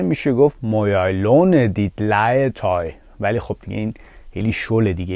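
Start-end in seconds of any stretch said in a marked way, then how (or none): 0.6-2.9 slow, fully sounded "Må jeg låne dit legetøj"; slow careful version. Shown as a demonstration. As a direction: say "Må jeg låne dit legetøj" slowly, each word given its full sounds. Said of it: "خیلی سلیس بخوایم بگیم که خیلی هم درسته". (شمرده، آهسته و کامل)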